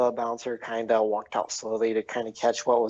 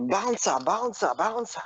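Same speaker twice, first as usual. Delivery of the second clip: high-pitched calling out, no real volume; mock shout; 9 semitones higher than usual. Sin shouts for his bouncer, high mock shout. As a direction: call the name in high, repeated bursts. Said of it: "he begins to shout".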